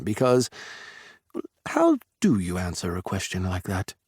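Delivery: ordinary delivery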